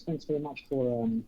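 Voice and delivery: ordinary talk, nothing marked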